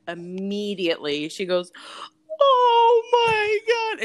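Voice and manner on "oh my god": high, slow wailing cry; imitating mom sobbing. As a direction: sob it out like an overwhelmed mom. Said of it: "And she starts sobbing on the phone".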